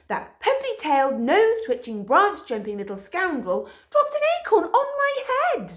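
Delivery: high outraged Poo Poo. A narrator's reading. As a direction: list the crimes with outrage. Poo Poo complains.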